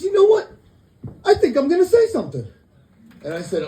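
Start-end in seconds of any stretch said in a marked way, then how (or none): 0.0-2.5 squeaky fed-up stranger working up to speak; high, excited mock stranger. Guy on bus voiced.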